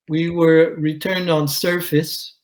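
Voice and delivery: ordinary delivery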